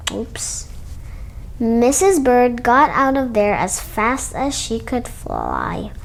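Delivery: ordinary delivery, nothing marked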